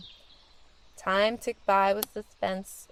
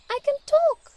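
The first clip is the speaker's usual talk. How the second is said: very high, loud squeak of wonder; a mouse amazed at its own voice; surprised and excited; 6 dB louder than usual. Acted. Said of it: "with surprise and excitement".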